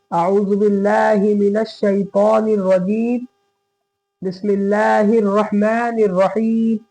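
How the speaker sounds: measured Quranic chant; Quran recitation. Shown as a demonstration. Teacher begins recitation for the class, chanted. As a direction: recite it in a slow tajweed tone. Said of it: "तो आइए पढ़ के देखते हैं".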